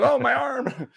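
high, hammed-up whine; mock pain. Fan pushed inside by his friend, high mock-pained whine.

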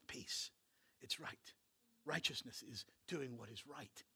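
soft hush. Acted. Whispered continuation of teaching.

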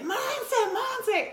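very high, drawn-out name call; high-pitched call across a crowd; imitated fan call. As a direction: mimic an excited fan hailing someone. Tourists calling out to her.

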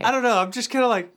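normal talking voice

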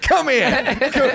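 mock angry. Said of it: "He's like".

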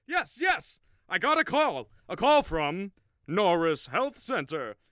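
high, loud and thrilled; excited. Real, not acted. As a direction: burst out with joy. Finally getting the call.

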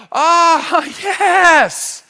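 high, loud rush of joy; coming up from baptism, elated cry; joyful exclaim. Retold or imitated. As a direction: burst out with relief after the water. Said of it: "you come up and you're like".

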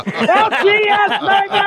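very high, laughing whoop; gleeful cheer. Real, not acted. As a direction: crow it out with glee. Gleeful shout after punchline.

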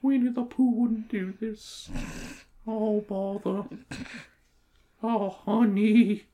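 soft, slow bear voice; Winnie the Pooh. Retold or imitated. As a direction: speak gently like a honey-loving bear. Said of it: "he was like".

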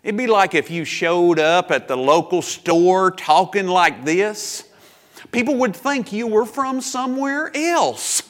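hammed-up Southern accent, slow and high; exaggerated Southern English. Shown as a demonstration. Preacher showing how an outsider's speech stands out, exaggerated Southern accent. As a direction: lay on a loud, drawn-out Southern accent. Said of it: "not Southern English".

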